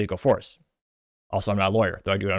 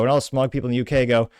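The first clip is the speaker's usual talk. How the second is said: normal talking voice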